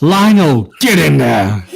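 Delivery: loud, high whoop of encouragement; cheering shout. Real, not acted. Player cheers on Lionel's attack, cheering shout. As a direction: yell it out like a roaring supporter.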